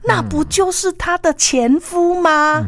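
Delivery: high, drawn-out disbelief; astonished. A narrator's reading. Storyteller reveals the intruder is the ex-husband, astonished. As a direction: play up the shocking reveal slowly and high.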